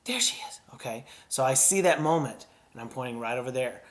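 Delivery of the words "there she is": breathy discovery. Shown as a demonstration. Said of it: "make my breathing like a little".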